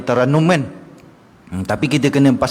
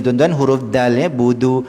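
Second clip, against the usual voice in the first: ordinary delivery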